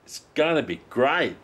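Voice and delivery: ordinary delivery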